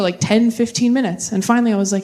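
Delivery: usual speaking voice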